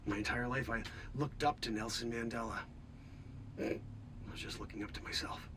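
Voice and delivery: soft, low stunned realisation; quiet awe. Acted. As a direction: let it sink in quietly.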